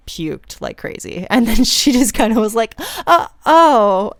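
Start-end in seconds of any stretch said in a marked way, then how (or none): 3.1-4.1 awkward uh-oh. Retold or imitated. Friend watching her vomit.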